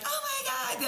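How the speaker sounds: high, squeaky retold panic; mock-terrified, voice shooting way up; panicked gasp.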